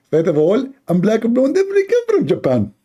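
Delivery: squeaky mock lecturer; mocking apologist. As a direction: play the know-it-all defender, voice pushed up.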